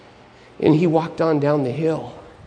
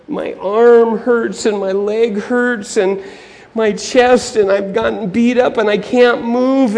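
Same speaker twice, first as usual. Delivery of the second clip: high, slow, pained complaint; hammed-up suffering victim; injured man; 7 semitones higher, 5 dB louder, slower than usual. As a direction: moan through the list of injuries.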